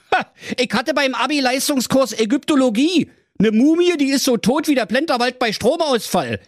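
high brash bragging swagger; cocky prince voice. Acted. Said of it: "und sprach".

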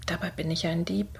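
very soft, quick aside; hushed, hurried self-directed remark; whispering to himself. A narrator's reading. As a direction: confess it under your breath. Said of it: "flüsterte er vor sich hin".

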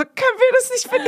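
very high, retold panic; hammed-up squeaky begging; panicked pleading. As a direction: squeal a desperate request. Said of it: "Und ich war so".

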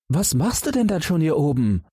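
high, outraged retort; indignant. A narrator's reading. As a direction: scold the frog in disbelief. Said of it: "gaben die anderen empört zurück".

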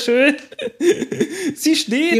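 high, laughing with joy; lachend vor Freude. Real, not acted. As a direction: laugh it out in relief. Jubel nach der Landung. (hoch und lachend vor Freude)

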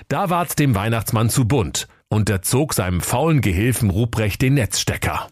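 plain reading voice

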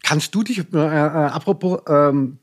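talking with food in mouth; mouth full. Real, not acted. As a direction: speak around a mouthful. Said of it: "Moment, ich hab was im Mund".

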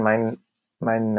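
ordinary delivery

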